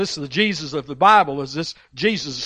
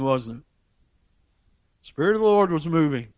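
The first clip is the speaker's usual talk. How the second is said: ordinary talk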